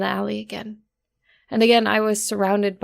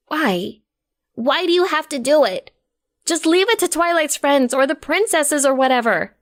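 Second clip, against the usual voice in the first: higher, pressing plea; Derpy, pleading; 6 semitones higher, 3 dB louder than usual. A narrator's reading. Derpy pleading with Rose to leave it, higher. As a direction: beg her to stop.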